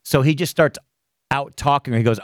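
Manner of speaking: ordinary delivery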